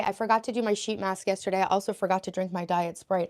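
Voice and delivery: ordinary talk, nothing marked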